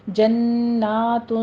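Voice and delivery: جنات with a long held nasal n; nasal ghunna. Shown as a demonstration. Teacher drills the ghunna in جنات. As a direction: hold the n of جنات for two counts. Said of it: "نون مشدد غنہ واجبہ".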